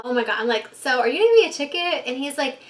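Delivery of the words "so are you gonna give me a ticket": hopeful. Retold or imitated. Hopeful question to cop.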